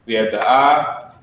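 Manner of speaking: normal talking voice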